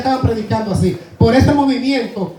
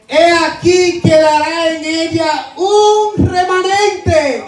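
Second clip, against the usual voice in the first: slow proclamation; 3 semitones higher, slower than usual. Acted. Proclaims God's promise.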